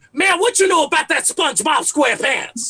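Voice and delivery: high, loud, badgering challenge; obnoxious old head. Comedian as future old head defending his cartoons, loudly obnoxious. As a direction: mimic your dad's old-head hollering. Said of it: "So I'm gonna be like".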